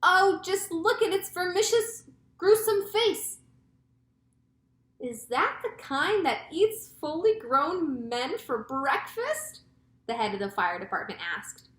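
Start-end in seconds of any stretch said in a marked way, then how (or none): 0.0-3.4 high, loud, slow horrified cry; drawn-out storybook dread; cried in horror. A narrator's reading. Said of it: "cried the chief of police". 5.0-9.6 high, slow, dreading question; drawn-out storybook fear; frightened question. A narrator's reading.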